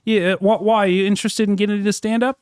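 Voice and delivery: put-on Aussie accent, held steady; Australian accent. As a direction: fake Australian accent in character. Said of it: "continued the Australian accent".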